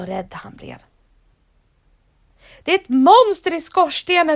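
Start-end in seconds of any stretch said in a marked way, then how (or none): 2.6-4.4 high, loud scared cry; a scared dad shouting from the roof; frightened shout. Acted. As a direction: yell it in panic at the monster. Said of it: "ropade han".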